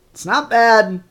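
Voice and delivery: normal talking voice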